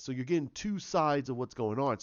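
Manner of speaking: ordinary delivery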